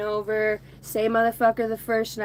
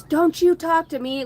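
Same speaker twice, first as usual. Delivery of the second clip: higher, louder mock confrontation; angry retort; 7 semitones higher, 5 dB louder than usual. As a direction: imitate a hostile man telling someone off. Retold camper snapping in a confrontation.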